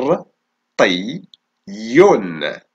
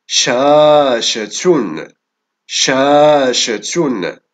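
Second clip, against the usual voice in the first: شاشة very slow and a bit louder; slow dictation; 2 semitones higher, 4 dB louder, much slower than usual. Shown as a demonstration. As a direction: hold each sound of شاشة. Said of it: "إذن حرف الشين في أول الكلمة".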